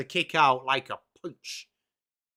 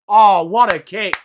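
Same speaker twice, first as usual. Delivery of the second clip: a loud, thrilled shout at a great kick; excited loud cry; 2 semitones higher, 13 dB louder than usual. Real, not acted. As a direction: belt it out like a fan watching the kick sail through. Great kick during live match.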